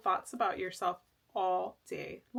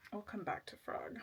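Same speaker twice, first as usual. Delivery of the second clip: whispered aside; 12 dB quieter than usual. Real, not acted.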